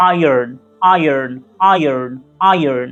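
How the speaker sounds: আয়রন with a strong American r, said slowly; American iron. Shown as a demonstration. Teacher shows students the American way to say iron. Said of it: "আমেরিকানরা একটুকে জোর দিয়ে বলে আরটাকে".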